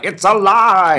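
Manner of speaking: high, loud, drawn-out triumphant cry; mad-scientist cry. Acted. Guest quoting the Frankenstein film, hammed-up exultant cry. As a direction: stretch it out like a hammy mad scientist.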